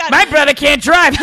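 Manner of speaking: loud, high radio-host parody breaking into laughter; Car Talk host. Retold or imitated. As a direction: crack yourself up like a mocking radio brother. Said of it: "all they do is laugh the whole show".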